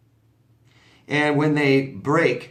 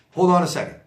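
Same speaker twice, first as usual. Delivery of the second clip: higher, louder, offhand stall; dismissive brush-off; 6 semitones higher, 5 dB louder, faster than usual. Shown as a demonstration. Showing how to stall a pushy coworker, dismissive. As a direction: wave them off while you check your phone. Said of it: "Oh, say".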